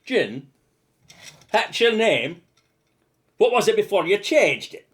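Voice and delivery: loud, high Scottish movie gangster; Sean Connery. Retold or imitated. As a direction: bellow like a Scottish tough cop. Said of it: "You wanna do a Sean Connery?".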